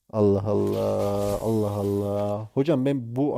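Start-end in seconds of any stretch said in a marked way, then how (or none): 0.1-1.4 slow, lower, drawn-out exasperation; exasperated drawl. Real, not acted. Streamer fed up with a rude chatter, dragging it out. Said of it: "sinirli girdim". 1.4-2.5 slow, softer, resigned; quieter exasperated sigh. Real, not acted. Streamer trailing off in resignation after the first sigh. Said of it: "sinirli girdim".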